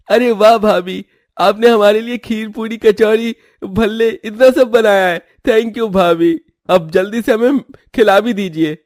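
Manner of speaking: light up at all the food; delighted. Acted.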